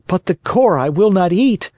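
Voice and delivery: high-pitched, mildly cross puppet; angry tone. Acted. Pinocchio refuses to eat the core, high and cross. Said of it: "cried Pinocchio in an angry tone".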